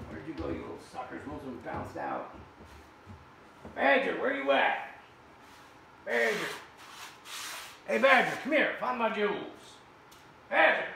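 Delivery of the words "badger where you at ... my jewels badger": loud, high call to the dog. Real, not acted. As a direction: holler across the shop for the dog. Calling dog across the shop.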